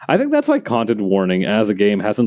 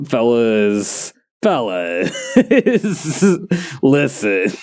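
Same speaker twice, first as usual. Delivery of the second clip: awkward hesitation; much slower than usual. Retold or imitated. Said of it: "scratching the back of your neck".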